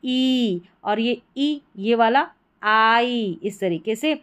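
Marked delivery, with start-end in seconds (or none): 0.1-0.6 ई stretched out long; long, sustained ई; drawn-out long vowel. Shown as a demonstration. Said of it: "खींच कर बोलना है". 2.6-3.4 आई stretched slowly; long, lingering आई; drawn-out long vowel. Shown as a demonstration. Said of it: "खींच कर बोलना है".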